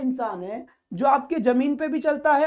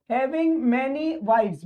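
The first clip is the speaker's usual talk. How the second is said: slow reading; much slower than usual. Shown as a demonstration. Teacher reads phrase slowly.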